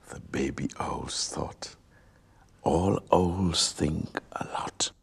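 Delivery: soft unvoiced storyteller whisper. A narrator's reading. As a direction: whisper it like a secret to a child. Whispered narration.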